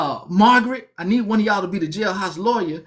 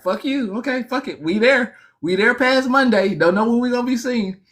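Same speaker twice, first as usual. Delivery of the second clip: defiant cellmate; 6 semitones higher than usual. Retold or imitated. Cellmate giving up on the newcomer, higher and defiant. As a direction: toss it off in a raised, brash voice. Cellmate after swallowing balloons.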